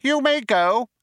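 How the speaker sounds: very high mouse voice, sharply; sharp dismissal. A narrator's reading. Benji dismisses the old man, sharp mouse voice. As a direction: cut him off in a high mouse voice. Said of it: "said Benji Mouse sharply".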